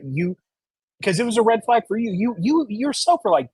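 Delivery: ordinary talk, nothing marked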